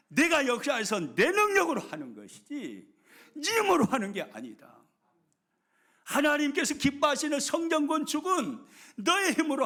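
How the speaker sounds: loud, high, forceful preaching as God; impassioned divine proclamation. Acted. God assuring Zerubbabel it is not by human strength, impassioned proclamation. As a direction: proclaim it with full pulpit force.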